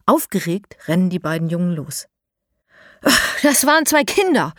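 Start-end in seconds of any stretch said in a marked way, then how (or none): 3.4-4.5 high, loud and out of breath from running; panting. A narrator's reading. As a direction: gasp it out after the sprint. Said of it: "keucht Mo". (atemlos keuchend, laut und hoch)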